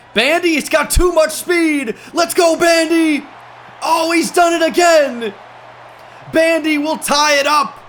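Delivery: slow, stretched, high and loud yells; real hyped shouting; excited shouting. Real, not acted. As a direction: cheer the runner all the way to the end zone. Long touchdown run.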